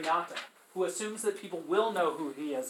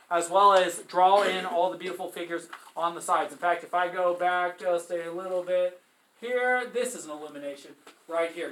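normal talking voice

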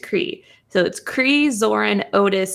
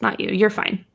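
normal talking voice

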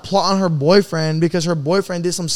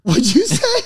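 laughing in disbelief; slower than usual. Real, not acted. Shocked at friend's blunt remark.

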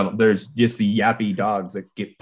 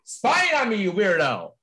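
high, loud mock outrage; mock yelling; 12 semitones higher, 4 dB louder than usual. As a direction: yell at a snooping friend. Yelling at Dallas in his room.

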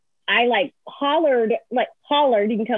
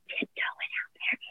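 hushed whisper, as if tiptoeing away; barely audible mock-stealthy whisper; sneaky whisper; 14 dB quieter than usual. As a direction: whisper it while creeping out.

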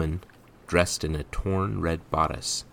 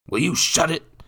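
raised, loud rebuke; angry stepfather; 12 semitones higher, 6 dB louder than usual. A narrator's reading. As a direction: silence the boy with a high, loud demand. Stepfather strikes the boy.

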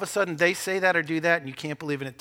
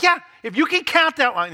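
higher, louder mock Jesus answering; playful Jesus voice; 10 semitones higher, 7 dB louder than usual. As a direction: tease it like a wry Jesus. Preacher voicing Jesus jokingly.

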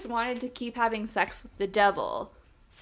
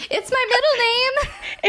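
laughing; 13 semitones higher, 5 dB louder, slower than usual. Real, not acted. Joking false claim, laughing.

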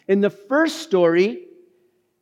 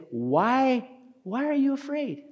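high, softer plea; gentle questioning; 8 semitones higher, 5 dB quieter than usual. Acted. Jesus asking frightened disciples, gently questioning. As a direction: ask it softly, pitched up.

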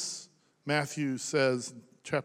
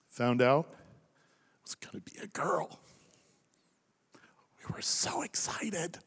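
choked up; 3 dB quieter than usual. Real, not acted. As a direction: voice thinning to a whisper. Recalling late wife's pregnancy, choked up.

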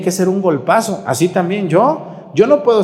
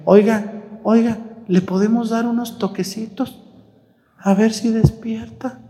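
polite medic; 7 semitones higher than usual. Retold or imitated. Voicing polite paramedic.

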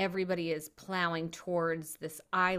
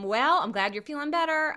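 normal talking voice

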